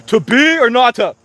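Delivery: high, loud Shakespearean declaiming; hammy theater actor. Pretend. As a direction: ham it up like a bad stage actor. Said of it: "He's trying to do theater".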